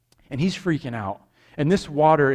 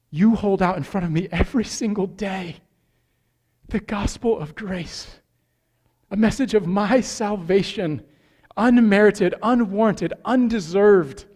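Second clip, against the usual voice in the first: higher, louder, rhythmic sermon build; full-force preaching; 6 semitones higher, 5 dB louder than usual. Acted. Pastor drives the point to the congregation, preaching hard. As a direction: hammer each every time home.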